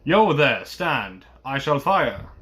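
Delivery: loud shouted warning to halt; roaring challenge. A narrator's reading. Guard halting an unseen rider, roaring. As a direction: call out a threat, hardest at the start. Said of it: "sang out as loud as he could roar".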